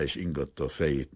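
ordinary delivery

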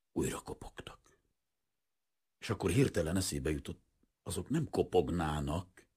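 usual speaking voice

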